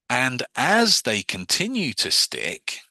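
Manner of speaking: slow, deliberate hypnotic phrasing; slow suggestion. Shown as a demonstration. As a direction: stretch each word to lead them. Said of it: "so now I'm presupposing they're sticking".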